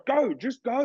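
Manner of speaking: high, urging coach voice; imitating the coach. Coach Thierry urging him forward while sparring, high mock urging. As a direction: push him on like a coach in the corner. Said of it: "the Thierry's like".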